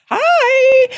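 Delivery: bright high squeaky hi; a high, perky little greeting; mock cheerful kid greeting; chirpy hello. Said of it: "presenting as someone who's like".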